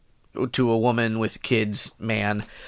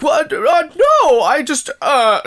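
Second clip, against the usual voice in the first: high, flustered denial; hammed-up embarrassed stammer; caught-out dad; 13 semitones higher, 5 dB louder than usual. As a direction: squeak out a panicked denial. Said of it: "He's like".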